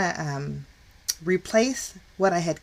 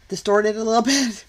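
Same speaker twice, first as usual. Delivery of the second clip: laughing; 4 semitones higher, 5 dB louder than usual. Real, not acted. Apologising for distorted screen.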